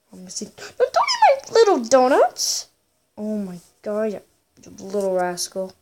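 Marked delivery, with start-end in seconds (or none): 0.6-2.7 high-pitched squealed plea; high protest. Real, not acted. Player guarding little donuts from rivals, high pleading protest.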